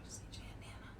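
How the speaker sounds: hushed picture aside; whisper. Real, not acted. Reader whispering to kids about the illustration. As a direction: whisper it like a secret.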